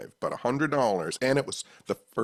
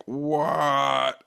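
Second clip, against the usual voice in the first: raised, drawn-out disbelieving "what"; stunned reaction; 5 semitones higher than usual. Retold or imitated. Hearing his date's cousin is a film star, stunned disbelief. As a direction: relive being floored by the news.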